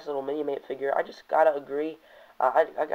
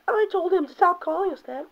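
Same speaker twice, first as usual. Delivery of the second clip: far higher squeaky mock falsetto; hammed-up Firestar, much higher; girly Firestar voice. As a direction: squeak it in a silly girl voice. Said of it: "Firestar saying".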